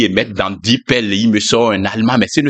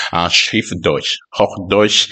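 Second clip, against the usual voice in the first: slow, careful German pronunciation; German word; 5 semitones lower, slower than usual. Shown as a demonstration. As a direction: say Schriftdeutsch like a German.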